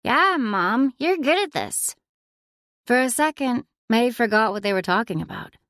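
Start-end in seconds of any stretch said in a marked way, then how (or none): 0.0-2.0 put-on a bit higher, bright kid voice; a hint of a cheerful daughter; lightly voiced young girl. A narrator's reading. Zoe, the child, praising.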